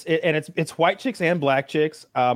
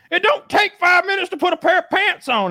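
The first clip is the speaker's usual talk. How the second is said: high, louder mock complaint; hammed-up exasperated officer; fed-up cop; 4 dB louder than usual. Guest playing the cop scolding her slowness, high and fed up.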